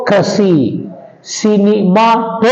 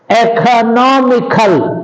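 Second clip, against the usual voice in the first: Economical slow and higher, NOM stressed; 4 semitones higher, slower than usual. Shown as a demonstration. Teacher giving a stress example, Economical slow with NOM stressed. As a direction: raise and stretch Economical, leaning on the NOM. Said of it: "on the anti-penultimate syllable".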